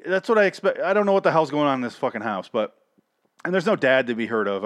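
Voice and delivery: ordinary talk, nothing marked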